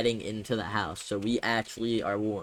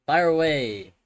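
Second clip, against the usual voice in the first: shouted command; 4 semitones higher, 7 dB louder than usual. Acted. Firing cannons.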